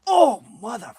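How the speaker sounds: high, strained curse through back pain. Retold or imitated. Retelling yawning into a pinched nerve, high pained cursing. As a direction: swear as the pain hits. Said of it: "and then I was like".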